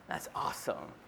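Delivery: hushed amazement, barely voiced; whispered aside. Real, not acted. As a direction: whisper it to yourself in wonder. Awed aside after Paul's words.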